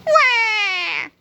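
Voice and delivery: high, drawn-out cartoon bawl; mock sobbing wail. A narrator's reading. Melvin has lost his powers, mock sobbing wail. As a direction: wail like a crybaby. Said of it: "sobbed Melvin".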